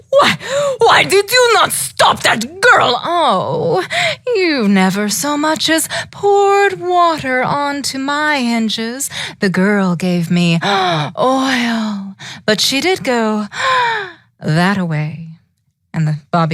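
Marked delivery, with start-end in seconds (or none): 0.1-3.0 high, loud witchy demand; witch voice. Acted. Baba Yaga voiced in story. 3.0-15.3 higher put-on voice, with gasps; creaky gate voice.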